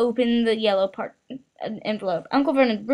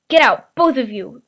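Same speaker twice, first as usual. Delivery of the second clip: louder croaked order; 8 dB louder than usual. A narrator's reading. Uncle ordering both boys out, croaked. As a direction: croak them out of the room. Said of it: "croaked Uncle Vernon".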